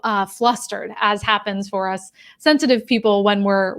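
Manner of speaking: normal talking voice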